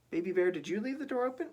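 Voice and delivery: quick high questioning mama; Mama Bear. Acted. Mama Bear asking Baby Bear about the door, high and quick.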